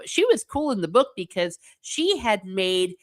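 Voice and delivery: ordinary delivery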